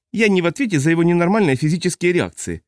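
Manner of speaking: high, testy, prickly man's voice; irritable high-pitched man. A narrator's reading. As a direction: snap back, high and peevish. Ozden's voice again.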